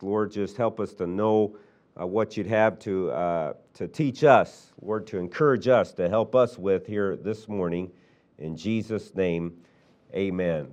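soft, hushed prayer voice. Acted. Pastor praying for insight before preaching, softly and humbly. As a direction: pray quietly and humbly before the sermon.

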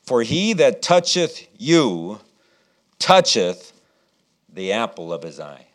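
slow, weighty reading of "toucheth"; emphatic scripture reading. Acted. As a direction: lean on "toucheth" with a pause after. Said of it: "here's what I want you to see".

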